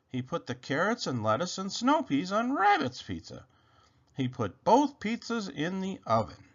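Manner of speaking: usual speaking voice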